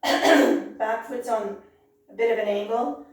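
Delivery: strained, hoarse voice; pushing through a hoarse throat. Real, not acted. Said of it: "now I'm losing my voice".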